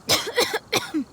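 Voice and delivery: strained cough from illness; a pained fit of coughing; sick cough. Acted. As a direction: cough through her weakness. You're still recovering.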